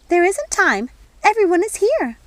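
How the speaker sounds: a busy fairy godmother fussing over tea; fussy fairy godmother. Acted. Fairy godmother stops Nanny.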